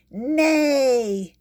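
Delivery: loud, high insistent nay; stamping-foot kitty nay; emphatic nay. Acted. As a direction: shout the nay so they finally hear. Kitty insists louder each time.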